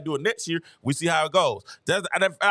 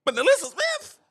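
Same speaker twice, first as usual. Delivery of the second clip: high, rising disbelief; can't believe that pick, pitch up; incredulous; 9 semitones higher than usual. Real, not acted. Scoffing at the coach who picked Alyssa Smith, incredulous.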